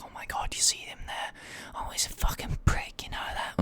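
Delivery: imitating hushed, catty whispering; whispered gossip. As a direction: whisper it behind someone's back. Imitates girls gossiping as he passes.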